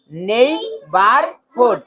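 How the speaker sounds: slow "নেইবারহুড" in three beats; syllable by syllable. Shown as a demonstration. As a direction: sound out নেই-বার-হুড. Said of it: "একবারে যতটুকু অংশ উচ্চারণ করতে পারবো".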